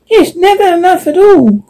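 very high little squirrel voice; squeaky squirrel. Acted. Squirrel agreeing, pitched very high. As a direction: squirrel agreeing eagerly with the crow. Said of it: "agreed the cur-, squirrel".

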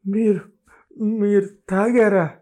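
a bride afraid to ask; slow scared stammer; fearful. A narrator's reading. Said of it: "అంది భయంగా చూస్తూ".

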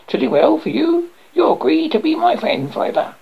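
bargaining frog. A narrator's reading. Frog states his bargain to princess.

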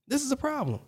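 soft repeat. Acted. Repeats point, much softer.

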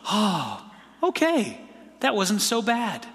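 a high, surprised "okay" then easing off; relieved surprise. Retold or imitated. Someone finding the feared step easy, mildly relieved and surprised. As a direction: let the relief break out on okay.

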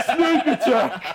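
high, slow, laughing dopey yell; dumb half-orc shout. Retold or imitated. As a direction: repeat the boast, still giggling. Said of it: "dumb half-orc ... he goes".

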